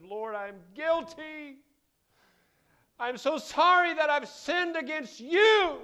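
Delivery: slow, high, loud wailing plea; David prostrate, crying out his guilt; anguished cry of repentance. Acted. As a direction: cry it out to God from the dirt.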